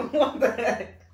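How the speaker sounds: loud, high shocked outburst; shocked shout. Real, not acted. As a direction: yell it in disbelief. Reacts to disgusting food.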